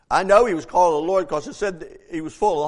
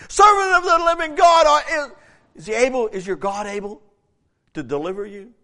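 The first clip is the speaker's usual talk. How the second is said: high, loud anxious cry of Daniel's name; lightly voiced anxious king; anxious king calling; 6 semitones higher, 6 dB louder than usual. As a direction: call down into the den, anxious. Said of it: "he comes into".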